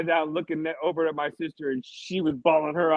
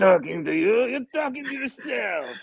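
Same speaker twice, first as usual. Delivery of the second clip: a put-on doll voice, higher and different; 5 semitones higher, 4 dB louder than usual. The doll Wado snapping back at its owner, put-on doll voice. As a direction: answer as the little doll, snippy.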